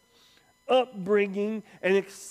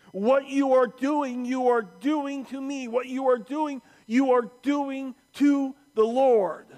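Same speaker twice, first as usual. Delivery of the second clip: higher, forceful, hammered phrases; Jesus confronting Paul; 6 semitones higher, 4 dB louder than usual. Acted. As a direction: drive each phrase home with rising force. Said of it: "And then he says".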